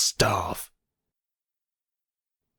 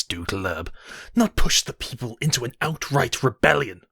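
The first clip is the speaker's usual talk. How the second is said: furious shouting; 3 semitones higher than usual. Acted. Emperor berating the governor over riots, furious shout. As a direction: shout them down in fury.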